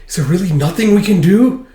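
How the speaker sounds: high, loud exasperated outburst; acted frustration. Acting out frustrated character.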